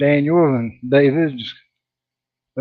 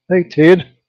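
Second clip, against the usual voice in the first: ordinary talk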